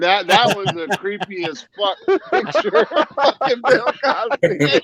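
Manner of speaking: laughing. Real, not acted. Scary Bill Cosby picture.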